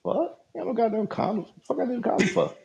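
high, stunned inner outburst; disbelieving what he just heard, inner cry; mock outraged disbelief. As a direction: act out the shock of the thought. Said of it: "that was my thought".

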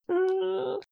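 small, very high-pitched held hum; awkward stalling hum. Acted. Imitating Eleanor caught on the spot, awkward high hum. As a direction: hum high and soft, as if stuck for an answer. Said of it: "like Eleanor trying to be like".

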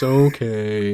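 small, dejected mock "okay"; mock sad. Mimicking the dumped boyfriend's sad reply, mock sad. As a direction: give a drawn-out, crestfallen okay. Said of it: "he makes the saddest cuck face".

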